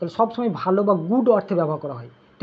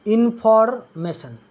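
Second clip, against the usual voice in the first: Information stretched very slowly, each syllable drawn out; slow drawn-out answer; much slower than usual. Shown as a demonstration. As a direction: drag out information slowly so the class catches it. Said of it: "কমিউনিক মানে কি বলতো?".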